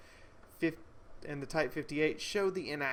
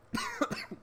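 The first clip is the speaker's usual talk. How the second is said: coughing. Real, not acted. Oh my god, I just swallowed a fly.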